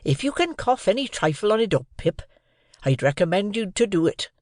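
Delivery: mildly aghast Joe. A narrator's reading. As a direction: urge him in dismay as Joe. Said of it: "said Joe, all aghast".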